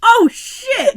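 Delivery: high, loud shocked exclaim; surprised. Real, not acted. Friend hearing the story's twist, loud shocked exclaim. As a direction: burst out in shock. Reacting to the punchline.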